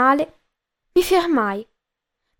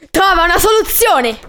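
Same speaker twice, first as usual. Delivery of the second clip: loud, higher-pitched barked order; ordine urlato; 5 semitones higher, 6 dB louder than usual. A narrator's reading. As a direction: shout it as a sudden command. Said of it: "Ordinò". (ordine gridato, imperioso)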